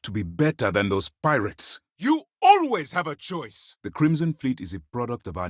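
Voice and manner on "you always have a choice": slow, high-pitched, forceful insistence; emphatic rebuke. Acted. Commander rebuking someone over their choices, emphatically. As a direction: push each word out slowly and hard. Firm rebuke in acted confrontation.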